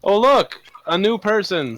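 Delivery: high bright mock surprise at a newcomer; excited greeting. Player voicing a fallen character greeting the stranger, mock excited. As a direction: perk up at the newcomer. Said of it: "says the person who just fell over here".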